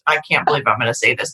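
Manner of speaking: embarrassed rush. Real, not acted.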